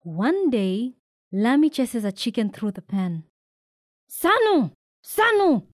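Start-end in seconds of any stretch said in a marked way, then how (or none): 4.1-5.7 high, loud calling; shout. A narrator's reading. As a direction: call the name across the pen. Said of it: "shouts the uncles".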